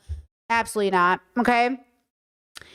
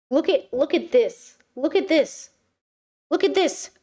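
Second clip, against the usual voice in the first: rising higher with each look at this; getting more worked up, look at this; excited repeat; 3 semitones higher than usual. Real, not acted. Vlogger showing a wild word, rising excitement.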